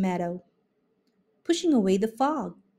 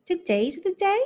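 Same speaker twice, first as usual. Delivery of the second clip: high, questioning fawn; a higher puzzled young fawn; 9 semitones higher than usual. A narrator's reading. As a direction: ask in wonder. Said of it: "questioned the fawn".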